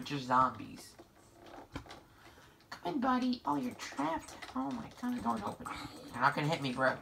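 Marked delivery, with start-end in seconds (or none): 2.7-5.7 high, hushed voice; soft coaxing. Real, not acted. Player talking to trapped buddy, soft high coaxing. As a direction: coax it quietly and gently.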